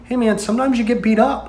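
high, soft easy reassurance; big brother calmly reassuring his beaten sibling; imitating brother. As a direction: casually reassure him. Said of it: "It was just like".

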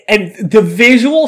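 usual speaking voice

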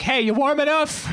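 high-pitched motherly call; hammed-up mom hollering a check-in; fussing mom. Mom popping up over a snowbank, fussing. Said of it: "my mom would just pop up".

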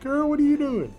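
put-on shocked high "Girl, what are you doing?"; mock shocked. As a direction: pretend scandalized outcry. Mock scolding about licking.

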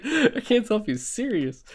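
a light hint of bafflement; mock baffled self. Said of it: "I was like".

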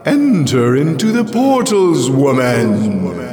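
loud, high, echoing summons; resounding alien voice. A narrator's reading. The voice summons Jirel into the portals, resounding and mocking. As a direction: call her in, mocking and ringing. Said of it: "It shook and resounded with a knowledge".

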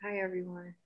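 soft, smooth late-night radio host. Pretend. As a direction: greet them like a smooth jazz station host. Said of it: "that's my late night radio voice".

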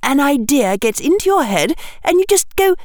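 put-on high, scolding young girl's voice; Hermione, acerbic. A narrator's reading. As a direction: lecture him with tart impatience. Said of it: "voice sounded a little acerbic".

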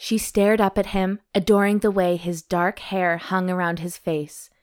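calm novel read-aloud; story narration. A narrator's reading. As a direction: read the romance chapter evenly. Reads romance novel aloud.

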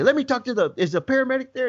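high, insistent retold father; exasperated dad. Dad demanding paramedic from son, exasperated. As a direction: imitate a dad demanding someone who will explain. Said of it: "I can hear the dad say".

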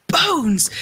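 high, loud mock shout; storyteller's hammed-up shout. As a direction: shout it up from a pit. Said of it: "Paladin shouted up from the deep".

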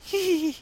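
very high little giggle imitating his kids; kid's giggle. Father imitating his kids giggling at the chant, high giggle. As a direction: giggle high like a sneaky child. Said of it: "you get that little".

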